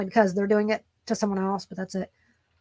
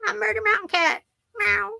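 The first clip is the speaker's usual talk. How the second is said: high, slow put-on cat voice; playful cat voice; voicing the cat; 11 semitones higher than usual. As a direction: speak for the cat, pitched way up. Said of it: "Oh, he said".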